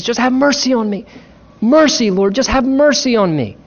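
high, pleading cry for mercy; a blind man begging Jesus for mercy. Retold or imitated.

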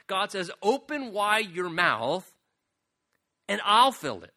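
preaching as God. Acted. Preacher voices God urging.

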